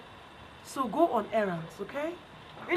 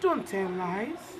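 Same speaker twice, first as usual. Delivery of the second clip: 1 slow moral; 4 semitones lower, much slower than usual. Shown as a demonstration. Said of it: "teacher stating a moral slowly".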